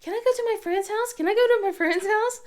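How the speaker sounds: put-on high, fast pleading child voice; whiny kid begging. Daughter nagging mom to visit a friend, whiny and high. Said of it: "bugging us".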